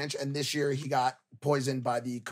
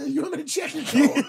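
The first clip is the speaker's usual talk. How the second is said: high laughing posh-staff impression; mock coat check guy; 12 semitones higher, 3 dB louder than usual. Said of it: "mocking coat check guy".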